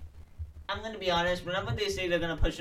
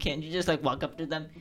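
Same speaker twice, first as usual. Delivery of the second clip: ordinary delivery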